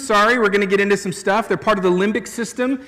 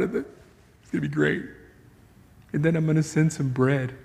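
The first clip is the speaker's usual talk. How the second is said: casual chatty God; 4 semitones lower, faster than usual. Retold or imitated. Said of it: "the God of the universe just says".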